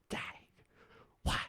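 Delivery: put-on breathless old lady whisper; grandma voice. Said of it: "That's a solid grandma voice".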